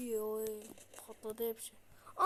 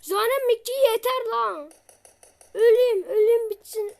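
higher, much louder despairing shout; exasperated yell; 7 semitones higher, 10 dB louder than usual. Real, not acted. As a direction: yell in defeat at the game. Boss beating him, exasperated.

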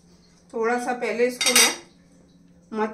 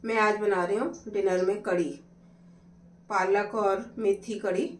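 ordinary delivery

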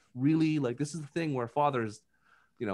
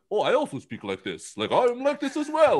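mocking a stiff British accent, pitched up; 10 semitones higher, 3 dB louder than usual. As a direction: poke fun with a hammy British accent. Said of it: "The Amber Heard British accent".

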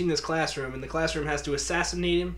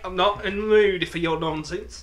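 higher, loud mock British accent; put-on British accent; 3 semitones higher, 6 dB louder than usual. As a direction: pick a mock fight as a British bloke. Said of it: "we're putting on accents".

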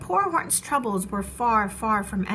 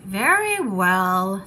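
drawn-out, slow giving in; slow reluctant; 3 semitones lower, 6 dB louder, much slower than usual. Acted. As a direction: sigh it out slowly. Elephant gives in.

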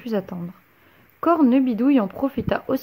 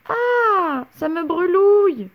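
very high pained cry; boy hopping in pain; burning yelp; 8 semitones higher, 3 dB louder, slower than usual. A narrator's reading. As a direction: yelp as if scalded. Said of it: "se tordit-il en tout sautant".

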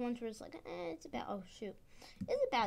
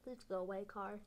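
usual speaking voice

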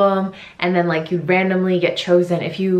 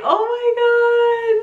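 imitating her excited squeal. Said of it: "Hyunbin's like".

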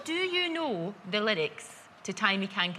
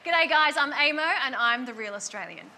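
put-on Australian accent. Contestant four claiming to be genuine, Australian accent. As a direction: introduce yourself as an Australian. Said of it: "The rest are putting on an accent".

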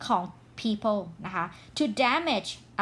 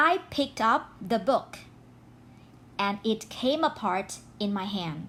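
slow example sentence; much slower than usual. Shown as a demonstration. Teacher reading her example sentence very slowly to students. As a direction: read it word by word, very slowly. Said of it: "ประโยคตัวอย่าง".

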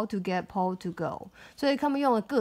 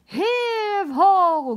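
loud straining chant, higher; heave ho pulling; 9 semitones higher, 7 dB louder than usual. Acted. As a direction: heave it out like pushing hard. Crowd pushing elephant.

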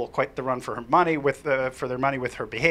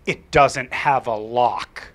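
slow, firm and louder; stone cold; 3 semitones lower, 5 dB louder, much slower than usual. Retold or imitated. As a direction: flatly correct the grown-up. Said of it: "she looks at me stone cold".

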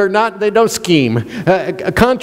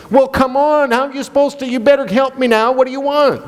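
impatient, higher pushy nagging of a partner; pushy demand; 7 semitones higher, faster than usual. Retold or imitated. Pastor mimicking a pushy partner, impatient and higher. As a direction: push the partner to decide, pitched up. Pressing partner in acted quarrel.

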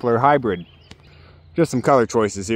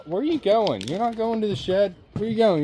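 higher, softer worried calls after the hen; high fretful calling; 8 semitones higher, 4 dB quieter than usual. Real, not acted. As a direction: chase her with your voice.

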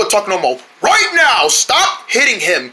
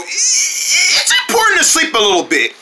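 high squeaky voice; 10 semitones higher than usual. Acted. Bug gives advice, voice jumps.

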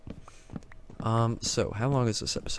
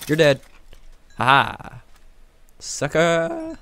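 loud, raised-voice victory crow; gloating taunt; 6 semitones higher, 9 dB louder, much slower than usual. Real, not acted. Gamer to the mob just killed, loud gloating. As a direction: crow over the beaten enemy.

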